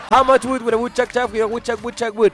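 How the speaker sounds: put-on high, loud American-accented tongue twister; American teacher twister. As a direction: rattle it off like the native teacher. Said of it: "me decía después la gringa".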